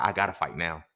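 ordinary talk

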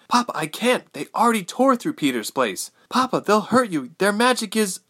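high, urgent daughter's protest; Ilse pleading. A narrator's reading. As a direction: plead with your father, voice pitched high.

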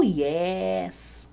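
ordinary talk

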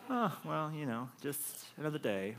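soft, laughing nonchalance; casual topper brushing off the boast, quietly; offhand one-upper. Retold or imitated. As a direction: shrug it off casually. Reply of a bored one-upper.

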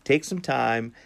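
pleading, please after please; begging plea. Real, not acted. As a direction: beg them to write in. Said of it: "do I sound like I'm begging?".

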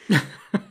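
a burst of loud, high laughter. Real, not acted. Laughs at the article.

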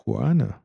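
"Porna" tried slowly by itself, falling at the end; practised pronunciation. Shown as a demonstration. Reviewer trying the actress's name over, practised pronunciation. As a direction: sound out "Porna" as a trial, with a falling tune. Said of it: "say that one more time".

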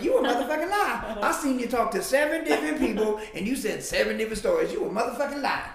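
high, loud mocking voice of the accuser; retold shout at him. Inmate yelling at the speaker, loud and high. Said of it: "He was like".

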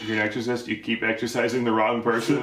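ordinary talk

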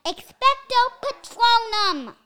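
slow, high, loud spell casting; proper spell casting. Shown as a demonstration. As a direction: cast Expecto Patronum with drawn-out, ringing syllables. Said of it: "that's the best I can do".

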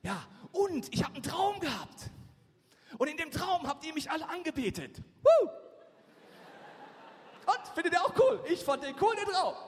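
high, loud, excited boasting; hammed-up braggart shouting out a dream; Josef. Acting Josef bragging to siblings.

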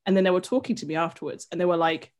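ordinary talk

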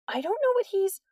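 high, rushed protest, cut off; high pleading protest. A narrator's reading. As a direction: blurt it out before being cut off.

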